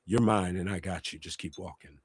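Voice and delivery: soft, fading near-hush of the father; gentle fatherly voice. Retold or imitated. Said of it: "my father spoke to me and said".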